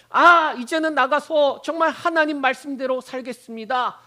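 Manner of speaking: loud, high, zealous pledge; fervent acted vow. Believer vowing to obey after hearing the sermon, fervently.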